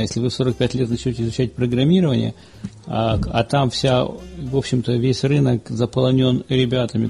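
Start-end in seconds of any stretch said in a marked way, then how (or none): none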